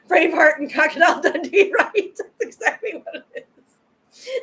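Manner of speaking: high-pitched, giggling through the words; laughing. Real, not acted. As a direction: talk while cracking up. Cracking up at own impression.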